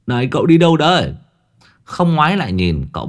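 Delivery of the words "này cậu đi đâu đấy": higher, louder call after him. Acted. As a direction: shout after someone walking away. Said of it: "gọi với theo". (Long gọi với theo, giọng cao và to)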